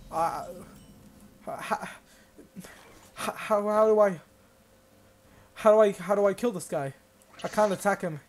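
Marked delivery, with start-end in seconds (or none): 0.1-6.9 louder, higher hammed-up despair; mock desperate. Gamer asking how to beat the toad in mock despair. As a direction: cry out, stuck for a plan.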